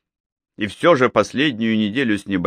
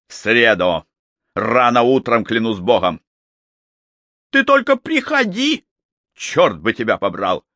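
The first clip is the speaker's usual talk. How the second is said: angry. Acted. Norbert's angry threat, leaving.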